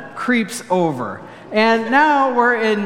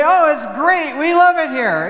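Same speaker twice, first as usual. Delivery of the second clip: gushing; 7 semitones higher than usual. Shown as a demonstration. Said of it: "I shouldn't say".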